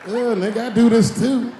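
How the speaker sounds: high, loud laughing protest; comic envy shouted at the show; jealous outburst. Retold or imitated. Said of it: "I'm like".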